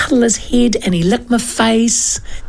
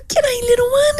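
put-on much higher, tender baby-talk greeting; high doting coo; cooing to a puppy. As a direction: coo it like you're greeting a newborn pup. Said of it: "And I went".

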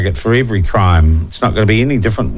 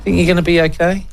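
mock concern; 7 semitones higher, faster than usual.

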